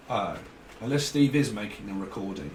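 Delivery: ordinary talk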